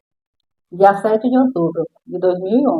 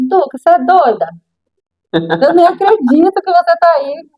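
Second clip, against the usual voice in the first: high-pitched retold disbelief; can't-believe-it exclamation, acted out; incredulous reproach; 8 semitones higher than usual. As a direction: scold a friend in disbelief, pitch raised.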